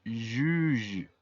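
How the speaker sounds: Juge said firmly in French; clear French model. Shown as a demonstration. Teacher repeats Juge loudly for the class. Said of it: "la pronunciación es juge".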